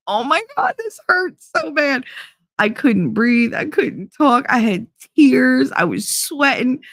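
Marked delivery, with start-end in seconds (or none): 0.1-2.0 high-pitched agonized exclamation, retold; pained reaction. Telling listeners how the pepper burned, pained high voice. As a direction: re-enact being overwhelmed by pain. Retelling her reaction to pain.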